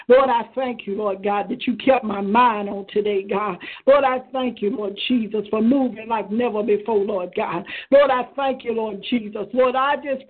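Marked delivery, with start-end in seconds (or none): none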